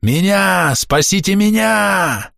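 high voice breaking on a desperate cry; cracking scream. A narrator's reading. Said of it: "закричал срывающимся голосом".

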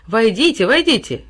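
loud and jolly; cheerful call. A narrator's reading. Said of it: "весело крикнул отец".

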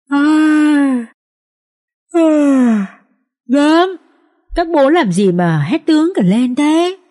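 high, slow, mock-drowsy drawl; sleepy voice. A narrator's reading. Thief pretending he was asleep, answering guards, mock sleepy. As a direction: fake just waking up. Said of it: "cất cái giọng ngái ngủ".

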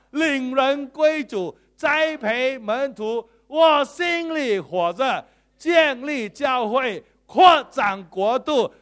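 slow, loud shouted creed; congregational declaration. Acted. Preacher leading the congregation in a loud, slow shouted declaration. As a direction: proclaim each phrase at full force to the church. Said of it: "大家说".

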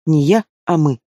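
mildly firm correction; a touch of insistence; categorical correction. A narrator's reading. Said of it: "категорично поправила она".

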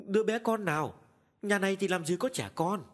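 high, loud baffled question; astonished. A narrator's reading. Wife is astonished by her husband's story, asking loudly. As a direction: sound truly puzzled by the claim. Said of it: "Bà Phú càng ngạc nhiên".

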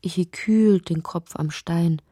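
usual speaking voice

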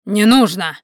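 «Не нужно!» рявкнуть громко и резко; резко оборвать, как командой; резкий рявк. Acted. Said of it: "рявкнул он так, что охранники у двери дёрнулись". (резкий командный рявк)

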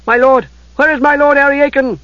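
high, loud panicked calling; frantic call. Acted. Squire calling desperately for his lord. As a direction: cry out searching for your master.

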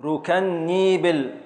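ركنيبل spoken very slowly, syllable by syllable; slow scansion. Shown as a demonstration. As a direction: drag out ركنيبل to show the beats. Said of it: "هنقف على اللام الساكنة". (تقطيع بطيء جدا)

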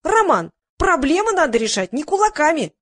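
high-pitched protest; a mild yell of outrage; indignant yelling. A narrator's reading. Front-row woman scolding Roman for promoting violence, yelling. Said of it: "завопила толстая тётка".

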